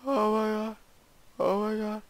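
shocked. Real, not acted. Shock at map trap.

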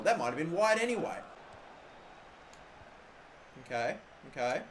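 normal talking voice

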